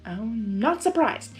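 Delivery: plain reading voice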